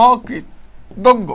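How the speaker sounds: অকৃতজ্ঞ in slow pieces with long gaps; teacher's slow model of অকৃতজ্ঞ; broken-up pronunciation. Shown as a demonstration. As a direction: sound out অকৃতজ্ঞ very slowly, pausing between syllables. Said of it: "সঠিক উত্তর হচ্ছে C নাম্বারটা".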